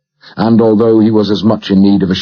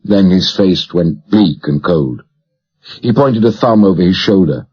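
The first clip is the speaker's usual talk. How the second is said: usual speaking voice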